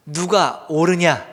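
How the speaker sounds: loud, drawn-out demand; booming acted challenge, stretched out; forceful challenge. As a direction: put the question slowly and loudly. Acts God challenging Jonah.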